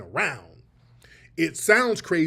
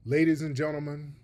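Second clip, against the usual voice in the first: ordinary delivery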